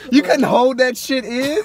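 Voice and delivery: loud, high, slow teasing through laughter; laughing mock scolding. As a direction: tease them while cracking up. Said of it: "and, like, laugh".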